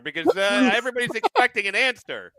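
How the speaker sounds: playful impatient boss calling Carl; mock boss calling. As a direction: hurry up the fire stoker. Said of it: "they're like".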